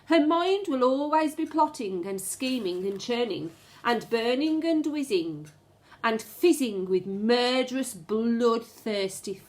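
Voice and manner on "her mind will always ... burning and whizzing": usual speaking voice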